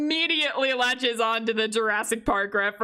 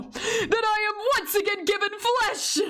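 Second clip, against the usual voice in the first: a laughing, higher mock British villain; giggling through a badly faked British accent; failed British accent; 7 semitones higher than usual. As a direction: try the British accent while cracking up. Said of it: "I can't do accents".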